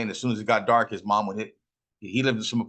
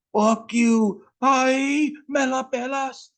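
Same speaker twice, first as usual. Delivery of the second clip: high, louder shrieking taunt; hurt kid shouting; 5 dB louder, much slower than usual. Retold or imitated. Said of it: "Ben tells him".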